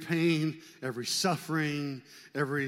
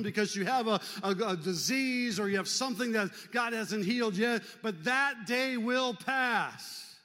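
impassioned preaching; 7 semitones higher than usual. Acted. Preacher urges suffering listeners.

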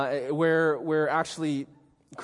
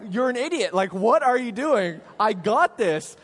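louder, higher comic indignation; mock exasperation; 5 semitones higher, 6 dB louder than usual. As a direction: re-enact a cocky, fed-up game partner. Acting exasperation at game partner.

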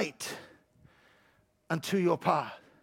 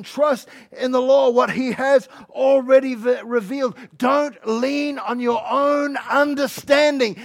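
loud, raised, emphatic sermon delivery; full-force preaching; 6 semitones higher, 10 dB louder than usual. Real, not acted. Preacher urging the church to trust the Lord, full-force preaching.

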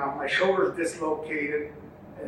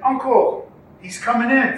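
raised, higher urgent call; urgent warning; 7 semitones higher, 5 dB louder than usual. Retold or imitated. Nephew warning his dazed uncle mid-race, urgent raised call. Nephew warns of incoming horse.

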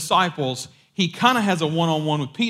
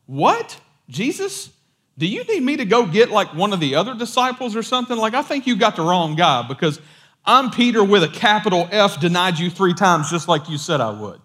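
high, loud, rushed incredulous protest; imitating flustered self-doubt; acted disbelief; 4 semitones higher, 5 dB louder than usual. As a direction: play a baffled disciple talking himself out of it. Acts Peter's disbelief at being chosen.

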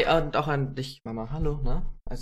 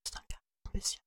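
ASMR-style whisper; breathy ASMR whisper; 9 semitones higher, 11 dB quieter, faster than usual. Pretend. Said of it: "A S M R".

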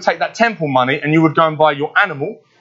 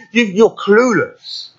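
higher, drawn-out scornful taunt; slow, lifted jeer at the giant; a touch of a defiant boy; David taunting; 5 semitones higher, much slower than usual. Retold or imitated. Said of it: "David is looking back at these events and saying".

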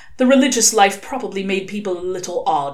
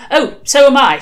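higher, louder eager agreement; grateful agreement; 7 semitones higher, 8 dB louder than usual. A narrator's reading. Father seizing on royal family topic, eager. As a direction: jump in with relief.

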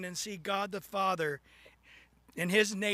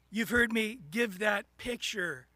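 normal talking voice

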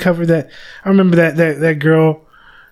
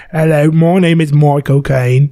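put-on slow, drawn-out British accent; Michael Caine. Podcaster mimicking the actor introducing himself, British accent. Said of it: "with a British accent".

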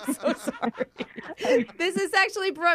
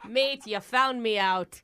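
slow, lower fake Aussie; drawn-out hammy Australian; put-on Australian accent; 5 semitones lower, slower than usual. Caller confessing to interviewer, badly faked Australian accent. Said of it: "totally different accent?".

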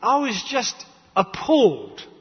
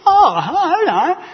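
high, louder, slow, laughing "oh hello"; laughing mock reply; 8 semitones higher, 5 dB louder, much slower than usual. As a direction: ham up the surprise through a laugh.

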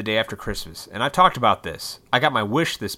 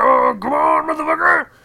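loud, high self-motivating roar; fired-up shout; 10 dB louder than usual. Acted. Acting out a burst of motivation, fired-up shout. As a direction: yell it like psyching yourself up. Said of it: "that motivation where you're like".

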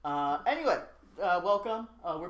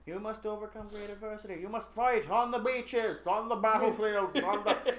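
hammed-up rousing speech; 5 semitones higher than usual. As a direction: build it like a wartime rallying cry. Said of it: "Would you stop singing?".

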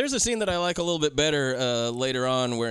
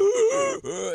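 loud high gasp; theatrical gasp of glee; dramatic gasp; 4 dB louder than usual. Retold or imitated.

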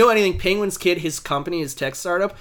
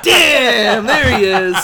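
higher and louder, laughing; laughing swoon; 5 semitones higher, 6 dB louder, much slower than usual. Retold or imitated. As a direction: gush over him through a laugh.

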